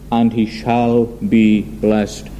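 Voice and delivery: very slow, deliberate quoted blessing; solemn pronouncement. Retold or imitated. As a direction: weigh out each word as a settled verdict. Isaac's firm confirmation, quoted by preacher.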